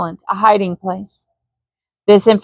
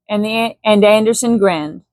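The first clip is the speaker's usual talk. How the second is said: ordinary delivery, nothing marked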